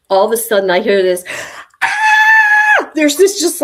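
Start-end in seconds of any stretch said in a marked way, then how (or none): 1.8-2.9 high, loud shout of delight; retold scream of joy. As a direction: let out the pharmacist's sudden joyful scream. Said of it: "like, screaming".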